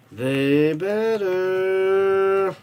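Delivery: very slow, stretched-out "They better"; a fan slowly stretching out his demand for a win; drawn-out insistence. Real, not acted. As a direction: drag every sound out like a warning. Hoping his team wins.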